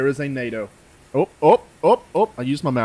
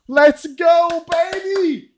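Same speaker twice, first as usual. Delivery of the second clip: very high, loud cheer; hype yell; 7 dB louder, much slower than usual. Real, not acted. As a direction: shout it out in pure hype.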